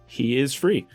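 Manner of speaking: ordinary talk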